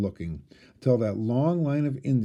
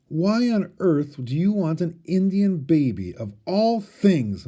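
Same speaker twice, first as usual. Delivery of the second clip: astonished; 5 semitones higher, 4 dB louder than usual. A narrator's reading. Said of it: "Ma asked her".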